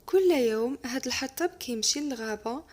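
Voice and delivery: usual speaking voice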